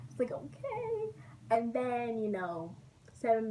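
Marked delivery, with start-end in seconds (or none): 0.3-1.1 tiny high crying voice; tearful reply. Retold or imitated. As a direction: squeak it out through mock tears. Crying child answering her mother.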